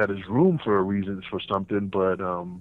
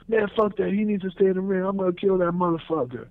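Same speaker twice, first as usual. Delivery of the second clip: much higher, heated; mock angry refusal; 10 semitones higher than usual. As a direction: insist he's getting him. Jack refusing, wants revenge.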